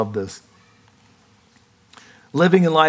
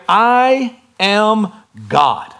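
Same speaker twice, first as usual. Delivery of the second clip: each word drawn out slowly; slow proclamation; 5 semitones higher, much slower than usual. Acted. Emphatic proclamation of Jesus' claim.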